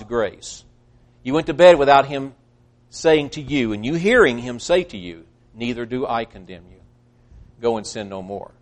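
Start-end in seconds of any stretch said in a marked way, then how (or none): 5.5-8.5 soft and low, tenderly; softly, as Jesus forgiving her; gentle forgiving words. Acted. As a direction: speak it like a quiet pardon. Jesus's words heard by sinner.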